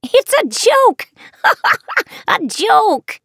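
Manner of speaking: shrill cackling witch laugh; high, slow cackle through it. Acted. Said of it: "the witch cackled".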